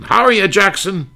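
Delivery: imitating [name]. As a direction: toss it off like a cocky bandleader. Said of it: "[name] used to call him Jackson".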